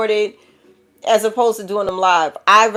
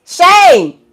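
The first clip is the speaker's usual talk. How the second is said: shouted shame; 12 semitones higher, 12 dB louder than usual. Real, not acted. Scolding family in a reading.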